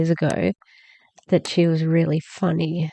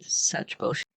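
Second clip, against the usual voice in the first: unvoiced scoffing whisper under the breath; whispered aside; 8 dB quieter than usual. Real, not acted. Co-host scoffing at the sales pitch, whispered. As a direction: mutter it so only the mic hears.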